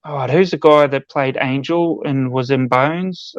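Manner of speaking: ordinary talk, nothing marked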